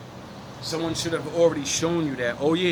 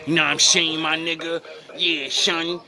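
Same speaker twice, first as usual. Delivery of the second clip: mock woman talking like a man; 2 semitones higher than usual. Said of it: "a woman talk like a man".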